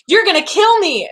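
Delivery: high-pitched hammed-up alarm; exaggerated freak-out squeal; mock panic. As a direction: play hysterical, voice leaping up. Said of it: "accurate reenactment of what happened".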